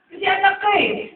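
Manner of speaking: high ringing preacher's shout. Real, not acted. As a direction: proclaim it to the back row. Shouted climax of sermon. (malakas na sigaw)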